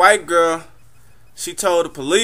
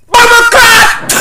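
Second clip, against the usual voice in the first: much higher, loud slow shout of a Jamaican store owner; Jamaican shopkeeper shout; 11 dB louder, slower than usual. Retold or imitated. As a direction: yell it at the window-shopper. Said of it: "a Jamaican store owner".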